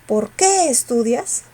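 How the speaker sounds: "qué" alargado y subido; stress on QUÉ. Shown as a demonstration. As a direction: marca "qué" con fuerza. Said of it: "se pronuncia con mayor fuerza". ("qué" con mayor fuerza de voz)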